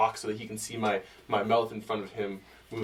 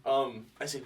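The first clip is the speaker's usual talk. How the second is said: ordinary talk, nothing marked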